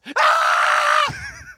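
loud, very high shriek; hammed-up falsetto scream of frustration; frustrated scream. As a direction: scream what you really want to say. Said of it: "the answer you wanted to give them was this".

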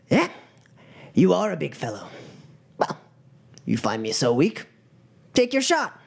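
measured taunt rising to a high final dare; gang leader goading. Acted. Gang leader facing the towering troll, coolly goading. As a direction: leave pauses, then dare him.

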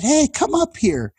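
high mock-cheery summons; imitated speaker calling. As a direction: call the kid up in a high teasing voice. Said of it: "And he said".

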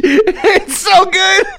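high, slow, laughing almost to tears; helpless with laughter. Real, not acted. Loving the movie exchange, laughing through tears.

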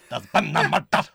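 loud, hammed-up Scottish detective; Scottish accent. Showing off the catchphrase to the co-host, loud Scottish. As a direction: belt out "murder" in broad Scottish. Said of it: "do it all in Scottish".

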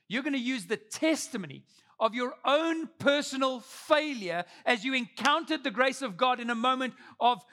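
impassioned, high-energy testimony; full-force preaching. Real, not acted. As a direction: preach it out with punched key words.

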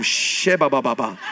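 put-on low lulling babble to a baby; baby soothing singsong. Father soothing crying baby.

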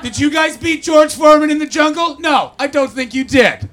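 loud, high laughing challenge; hammed-up boastful shout, slowing at the end; mock taunt. Real, not acted. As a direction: jokingly get in the crowd's face.

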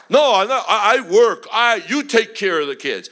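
loud, stammered mock protest; defensive protest. Preacher playing a dad who says he works, loud defensive protest. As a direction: act out a dad excusing himself.

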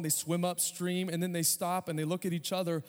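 usual speaking voice

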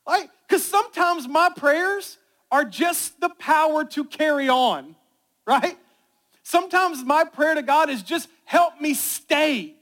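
loud, high, driving sermon voice; full-force preaching. Acted. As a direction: preach it out to the whole room.

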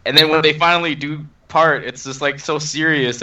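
ordinary talk, nothing marked

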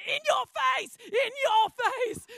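high, loud taunting yell, the second one slow; gloating yell. Retold or imitated. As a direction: crow it at the kids like a sore winner. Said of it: "yelled at all my kids".

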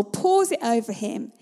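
normal talking voice

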